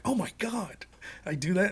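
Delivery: retold hushed dismay; mock horror. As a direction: ask it quietly, as if appalled at yourself. Retelling his shock at the prank.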